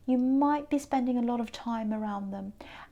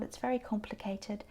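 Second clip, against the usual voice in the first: hushed whisper on the card's meaning; secretive aside about the card; 3 semitones lower, 7 dB quieter than usual. Real, not acted. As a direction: lean in and whisper it. Tarot reader confiding card meaning.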